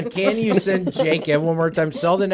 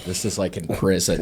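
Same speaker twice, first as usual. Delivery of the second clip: normal talking voice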